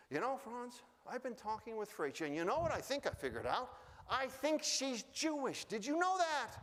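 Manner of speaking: high, lightly voiced wife with a knowing lilt; wife confiding. Wife Ming tells Franz she has worked out the girl's secret, lightly voiced. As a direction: play the wife letting her husband in on a secret.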